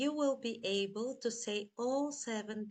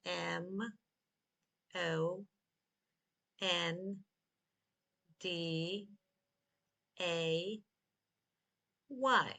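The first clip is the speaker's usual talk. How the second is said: slow letter by letter, long gaps; spelled slowly; 3 semitones lower, much slower than usual. Shown as a demonstration. Teacher has learners spell Monday with them, slowly. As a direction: spell it out slowly, pausing after each letter. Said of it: "Again, slowly".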